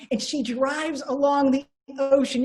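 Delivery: normal talking voice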